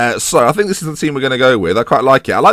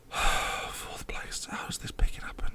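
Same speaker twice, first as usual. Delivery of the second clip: sighing whisper, much softer; 18 dB quieter, slower than usual. Real, not acted. Muttering over losing while checking the league table, a despairing whisper.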